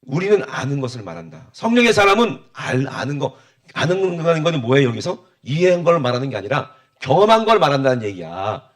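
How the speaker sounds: ordinary delivery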